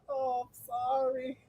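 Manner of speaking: soft, slow, sheepish apology; mock apology. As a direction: sound sorry to the kids asking about boating. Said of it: "I be like".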